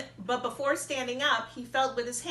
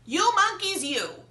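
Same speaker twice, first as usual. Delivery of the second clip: high, loud, slow shouting; furious peddler; 12 semitones higher, 9 dB louder than usual. A narrator's reading. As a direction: stamp and yell at them. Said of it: "getting very angry".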